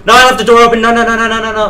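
high, loud panicked outburst; panicked shouting. Real, not acted. Player realising the door was left open, panicked high shouting. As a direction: rattle it out in rising alarm.

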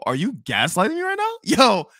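usual speaking voice

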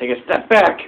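high, loud command yell; real shout. Real, not acted. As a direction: roar it at the boss. Shouting at boss in game.

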